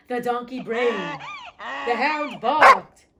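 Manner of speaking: storyteller hamming up a deafening animal concert; booming animal chorus. A narrator's reading. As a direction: belt out each animal's noise slowly like a brass fanfare. Animals perform their loud music.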